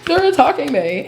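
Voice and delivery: high, put-on voice playing the victim; mocking whiny victim. Mocking the gossiper who claims to be attacked, high whiny voice. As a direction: mimic someone crying that they're under attack. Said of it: "you're like".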